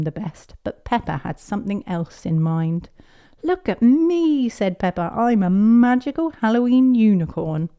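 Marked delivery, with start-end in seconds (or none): 3.4-4.5 high, loud, drawn-out cry; proud show-off child. Acted. Peppa showing off her unicorn costume. 5.1-7.7 put-on high, loud, proud little girl; grand child announcement. Peppa grandly presenting her unicorn costume.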